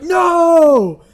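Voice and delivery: high loud scream; hammed-up horrified shriek; mock scream. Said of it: "It's like".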